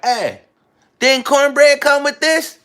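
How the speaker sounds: high loud demanding customer. Retold or imitated. Asking the restaurant staff where his cornbread went, high and loud. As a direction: pitch way up and loud, dragging it out. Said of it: "Money Boy doubled back and was like".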